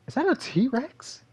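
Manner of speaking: mock surprise. Gamer spotting the T-Rex in footage, slow amazement. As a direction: stretch it out, eyes wide. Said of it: "I was like".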